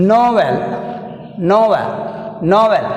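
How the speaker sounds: "Novel" with a firm NO, then a fall; teacher modelling "Novel", stressed at the start; first-syllable stress. Shown as a demonstration. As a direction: lean on NO in "Novel". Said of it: "First syllable is".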